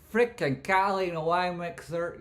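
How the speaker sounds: high, loud, stretched-out holler; big high-pitched hype yell; hyped catchphrase shout. Acted. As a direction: belt out the catchphrase. Hyped catchphrase, bigger second take.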